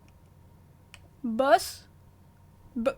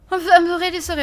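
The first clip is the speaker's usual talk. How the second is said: flustered apology; 4 semitones higher, 11 dB louder, much faster than usual. Real, not acted. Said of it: "I got really jump scared".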